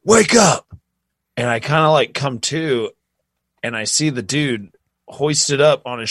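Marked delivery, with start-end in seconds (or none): 0.0-0.7 higher, louder mock wake-up yell; imitating someone yelling wake up; retold yelling. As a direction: shout him awake, rising on the second. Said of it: "I hear somebody yelling at me".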